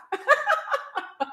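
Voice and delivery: a high, light burst of laughter. Real, not acted. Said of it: "I'm glad I make you laugh".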